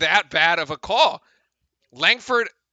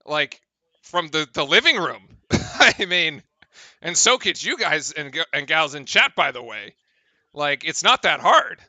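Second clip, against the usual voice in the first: usual speaking voice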